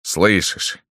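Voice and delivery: СЛЫШИШЬ stated with assurance; СЛЫШИШЬ as a confident, settled statement. A narrator's reading. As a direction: answer your own question surely with СЛЫШИШЬ. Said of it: "сказал уверенно Рустем".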